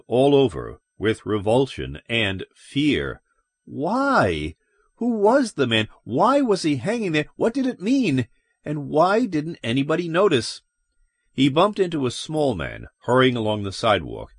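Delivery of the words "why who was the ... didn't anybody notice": high-pitched, bewildered questions; distressed disbelief, raised pitch; agitated questioning. A narrator's reading. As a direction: pile up the questions in alarm. Narrated panicked thoughts of Lois.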